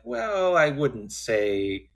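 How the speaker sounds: high, drawn-out hedging; evasive. Retold or imitated. Front desk clerk who can't say yes or no, evasive. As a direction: stall on a long, high "well". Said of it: "he can't say yes or no".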